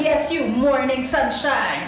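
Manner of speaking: slow, loud, high flirty drawl; waiter insisting. Retold or imitated. Waiter insisting to the guest, slow and loud. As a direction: lean into the charm, slowly. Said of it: "He's like".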